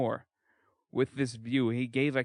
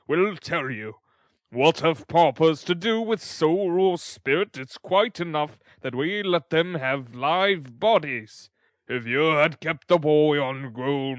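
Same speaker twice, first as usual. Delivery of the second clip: louder, pompous lecturing beadle; 6 dB louder than usual. A narrator's reading. Beadle scolding undertaker's wife, loud pompous lecture. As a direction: lecture her with self-importance. Bumble lecturing Mrs Sowerberry.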